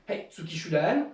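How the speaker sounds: barks the counts loud like a sensei leading the class; shouted dojo commands. Shown as a demonstration. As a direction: give each count a sharp, loud call. Leads dojo drill with shouted counts. (commandes de dojo criées, comptes lancés fort comme un sensei)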